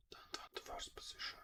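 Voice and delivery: whispered under the breath. Real, not acted. Trader reminds himself not to force a trade, whispered.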